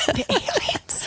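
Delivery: high giggling squeal, slow on the aliens; squealing giggle. Real, not acted. Friend squealing with laughter at alien joke.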